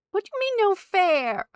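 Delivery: incredulous. Real, not acted. Mock outrage at chat.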